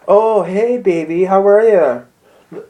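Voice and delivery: lightly voiced lover on the phone; sweet phone voice. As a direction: coo into the phone. Driver sweet-talks boyfriend on phone.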